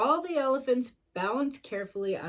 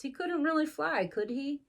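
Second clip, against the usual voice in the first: ordinary delivery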